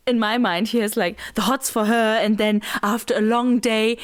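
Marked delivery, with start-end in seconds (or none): none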